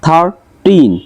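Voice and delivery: থার্টিন split as থার, টিন; two separate beats on থার্টিন; syllable by syllable. Shown as a demonstration. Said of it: "দুইটা দেওয়া আছে".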